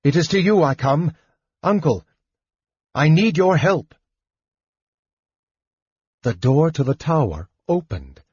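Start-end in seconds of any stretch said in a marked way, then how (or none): 0.0-3.9 high, urgent plea; crying out. A narrator's reading. Palin begs his unseen uncle for help, crying out. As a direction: call out higher, begging for help. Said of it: "Palin cried".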